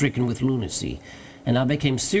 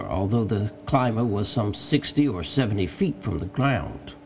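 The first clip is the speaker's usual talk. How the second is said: ordinary delivery, nothing marked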